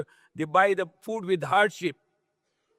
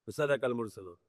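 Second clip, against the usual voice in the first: soft repeat; 8 semitones lower, 10 dB quieter, much faster than usual. Acted. Same verse repeated softly.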